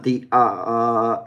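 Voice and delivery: uh drawn out slowly; slow uh demo. Shown as a demonstration. Teacher modelling the starting vowel, slow uh. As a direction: stretch uh slowly. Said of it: "It's the sound up front".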